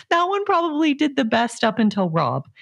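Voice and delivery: ordinary talk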